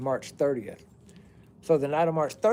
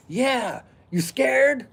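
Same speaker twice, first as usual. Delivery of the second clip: higher, louder mocking taunt; taunting dude; 9 semitones higher, 4 dB louder, much slower than usual. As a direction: jeer like a guy egging on.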